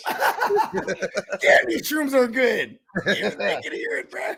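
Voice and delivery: high-pitched giggly delivery; laughing stoned. Pretend. Repeats stoned quote laughing.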